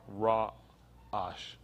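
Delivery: Ra-ash split into two slow parts, RA, ASH; low, careful Ra-ash, syllable by syllable; pronunciation demo. Shown as a demonstration. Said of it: "Pronounced R".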